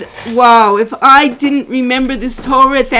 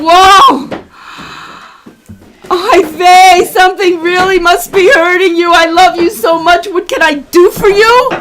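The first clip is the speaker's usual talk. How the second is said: loud, higher, heartfelt outpouring; emphatic loving concern; 4 semitones higher, 6 dB louder than usual. Acted. Teacher modelling a reply to someone yelling, loud loving concern. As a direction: answer a yeller with big-hearted sympathy. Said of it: "you even say to them".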